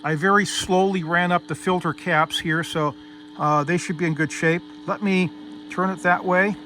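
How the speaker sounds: ordinary talk, nothing marked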